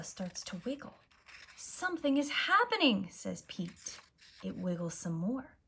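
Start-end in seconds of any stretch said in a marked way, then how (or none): 1.6-3.1 higher and louder, thrilled; eager discovery; excited. Acted. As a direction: call it out in excitement. Pete excited at pupa.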